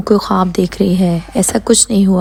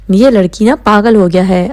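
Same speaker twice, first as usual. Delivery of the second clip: usual speaking voice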